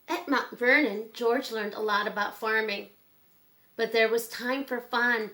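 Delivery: clear, gentle storybook read-aloud; reading to children. Acted. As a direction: read it like bedtime story time for kids. Reads picture book to children.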